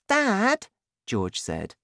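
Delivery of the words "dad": put-on much higher child's voice; young son. A narrator's reading. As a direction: ask Dad in a high boyish voice. Narrator voices young son George.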